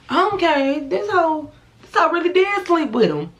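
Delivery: realizing the betrayal, furious and high; livid outburst. Retold or imitated. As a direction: let it explode in shocked fury. Livid on reading the messages.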